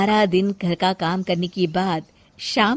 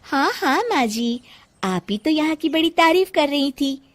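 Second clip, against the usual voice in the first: higher, playful, speeding up; teasing bahu; 7 semitones higher than usual. Acted. Janki teasing her mother-in-law, high and playful. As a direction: tease her sweetly.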